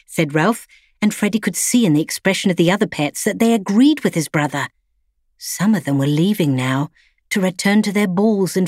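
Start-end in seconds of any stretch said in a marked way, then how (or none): none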